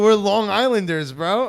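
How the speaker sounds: loud, slow hometown boast; hyped cheer. Real, not acted. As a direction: pump up the crowd. Hyping up Long Island pride.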